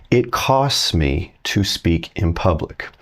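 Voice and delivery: each word laid out slowly, "it" leaned on; slow literal gloss. Shown as a demonstration. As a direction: spell out the literal meaning slowly for the learner. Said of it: "this is literally saying".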